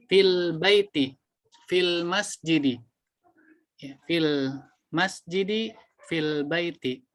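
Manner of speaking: "Fil baiti, fil masjidi" slow with final i; slow model. Shown as a demonstration. Teacher repeats the correct forms slowly for students. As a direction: say it slowly, landing on the i endings. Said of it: "setelah fi dikasrohkan".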